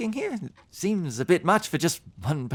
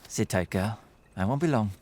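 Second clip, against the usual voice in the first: soothing an animal; 5 dB quieter than usual. Acted. Traveller soothing his horse outside.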